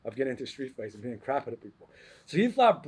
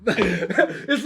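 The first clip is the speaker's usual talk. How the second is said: laughing; 5 semitones higher, 4 dB louder, much slower than usual. Real, not acted. Laughs at the bully's choice.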